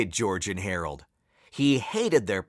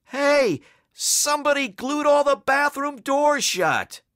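very high-pitched squawked complaint; high, urgent cry from the crowd; shouting; 13 semitones higher than usual. A narrator's reading. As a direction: holler it in a high character voice. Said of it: "with people shouting everything".

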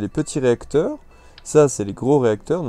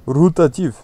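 louder, higher mock Russian accent; 5 semitones higher, 9 dB louder than usual. Player playfully repeats the wheel type to viewers, Russian accent. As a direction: punch the word out in a put-on Russian accent. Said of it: "je sais pas pourquoi j'ai pris l'accent russe".

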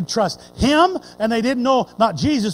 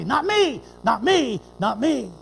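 passionate plea. Retold or imitated. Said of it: "that passionate plea".

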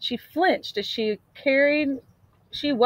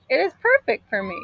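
quick, higher line for the woodcutter; a lightly voiced, eager woodcutter; pleased woodcutter; 12 semitones higher, faster than usual. A narrator's reading. Woodcutter speaks.

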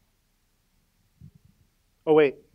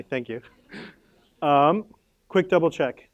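ordinary talk

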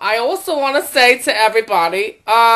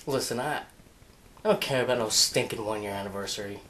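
low, very soft grumble; grumpy refusal; 14 semitones lower, 14 dB quieter, faster than usual. Acted. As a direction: refuse in a deep, subdued voice. Grumpy Richie refuses to congratulate.

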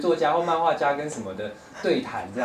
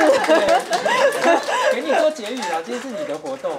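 laughing; 14 semitones higher, 11 dB louder, slower than usual. Real, not acted. Guest hands host the closing remarks.

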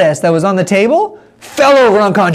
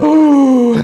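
crowd ooh; 6 semitones higher than usual. Acted. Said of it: "everybody went".

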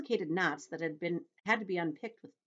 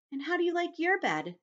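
high, lilting spider voice; kindly Miss Spider; 13 semitones higher, 4 dB louder than usual. Acted. Miss Spider asking James about his bed, high-voiced. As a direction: ask it in a high lilt.

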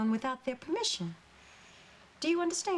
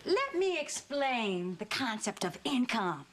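slow, measured explanation; deliberate lecture; slower than usual. Acted. Mother explaining income to teenage daughter, slow deliberate lecture. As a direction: spell it out slowly, phrase by phrase.

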